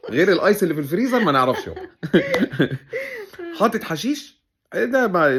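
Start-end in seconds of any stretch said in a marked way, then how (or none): 1.9-3.5 a burst of high-pitched laughter. Real, not acted. Laughing at the drug flyer. (ضحكة عالية)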